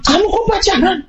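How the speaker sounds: high-pitched acted parental outburst; judgmental scolding. Father scolding teteh over a boyfriend, mock outrage. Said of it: "Mendengar tanpa menghakimi".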